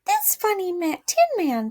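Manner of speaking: high queenly mouse. A narrator's reading. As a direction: proclaim it like a tiny queen. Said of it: "she answered".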